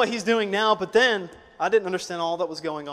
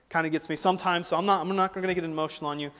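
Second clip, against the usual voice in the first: ordinary talk, nothing marked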